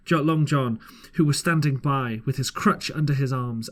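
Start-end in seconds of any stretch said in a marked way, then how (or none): none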